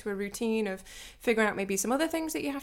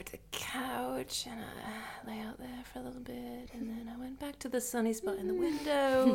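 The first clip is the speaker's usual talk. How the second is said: lazy house-cat whisper; 3 semitones higher, 5 dB quieter than usual. Pretend. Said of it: "I might have become a house cat".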